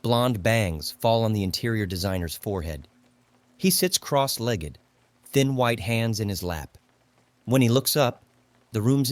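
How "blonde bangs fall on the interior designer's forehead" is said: ordinary delivery, nothing marked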